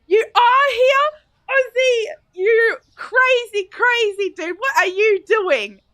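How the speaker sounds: high, loud, drawn-out squeal of excitement; real thrilled outburst, stretched words; delighted surprise. Real, not acted. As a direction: gush loudly at a friend who turned up. Delighted to find Ozzy present.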